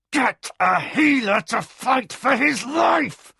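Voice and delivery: loud, high, slow demand; near shout. A narrator's reading. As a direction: shout it out, desperate. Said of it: "Kevin almost shouted".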